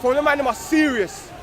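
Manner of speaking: loud, rising address to the brothers; rally the room like a preacher; rousing speech. Acted. Young man addressing the circle, rousing loud speech.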